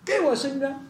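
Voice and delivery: high, repeated demand; imitated insistent pleading. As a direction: beg the judge over and over. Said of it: "就是不停地求".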